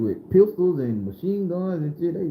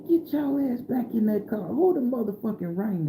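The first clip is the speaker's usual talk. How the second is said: raised high-pitched furious grandmother voice; Grandma yelling at strangers; 7 semitones higher than usual. Retold or imitated. Grandma confronting the men who cornered her grandkids, in a high furious voice. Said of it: "She like".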